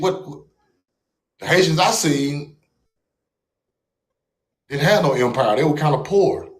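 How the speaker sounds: ordinary talk, nothing marked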